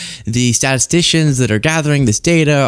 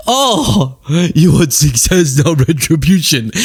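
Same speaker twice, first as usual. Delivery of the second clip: Doctor Phil; slower than usual. Retold or imitated. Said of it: "Doctor Phil would do this".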